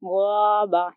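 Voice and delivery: गौबा with a full, heavy "ghau"; heavy ghain. Shown as a demonstration. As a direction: fill the mouth on गौबा. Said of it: "भर कर पढ़ेंगे".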